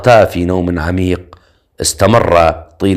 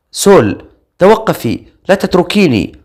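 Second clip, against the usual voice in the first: high, pleading call after a friend walking away; trembling call; 9 semitones higher than usual. A narrator's reading. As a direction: call out shakily, afraid of being left behind. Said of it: "فنادت بصوت مرتجف".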